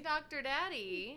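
drawn-out tease. Pretend. Drawn-out nickname joke.

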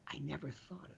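a near-silent, dropped-away voicing of "I never thought of that."; barely audible aside. The host voicing a listener's private realization, almost inaudible. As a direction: let it fall almost to nothing, like a private thought. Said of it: "I want you to say".

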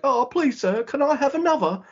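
high-pitched pleading English orphan; Oliver Twist. Retold or imitated. Co-host retells wrestler mocking his rival, pleading orphan voice. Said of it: "I'm not Oliver Twist".

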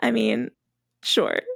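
usual speaking voice

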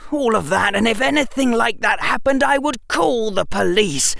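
high-pitched indignant outburst; shouted. A narrator's reading. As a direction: protest in outrage, stressing the key words. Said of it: "Harry shouted".

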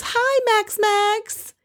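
fake-flirty greeting in a very high voice; Kelly. Mimicking Kelly greeting her date Max, fake flirting in a high pitch. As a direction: squeal it coyly, mocking a put-on flirt. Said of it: "does that fake flirting thing".

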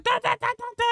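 mock babble. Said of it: "you were like".

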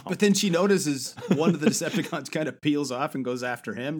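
ordinary talk

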